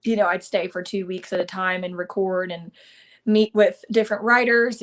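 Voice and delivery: usual speaking voice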